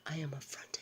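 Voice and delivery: whispered haughty line, barely voiced. Grandmother whispering Tabitha's indignant line to the child. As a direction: hiss the affront under the breath. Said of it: "she said".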